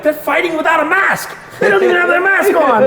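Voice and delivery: very high-pitched shouted commentary; mock outraged shouting. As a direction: call it out like you're reporting a scandal. Acts out his own video commentary.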